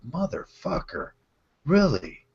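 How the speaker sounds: mock disbelief, pitch leaping on each "really"; incredulous rebuke. Biker retelling his reaction to an aging friend, incredulous.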